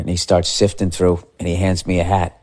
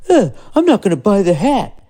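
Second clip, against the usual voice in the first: high whiny put-on voice, louder; petulant whine; 12 semitones higher, 4 dB louder than usual. Sulking to himself in the hat store, high and whiny. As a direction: whine it like a sulky kid.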